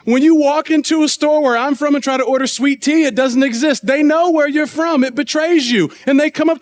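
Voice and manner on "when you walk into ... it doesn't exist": ordinary talk, nothing marked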